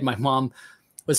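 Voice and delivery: usual speaking voice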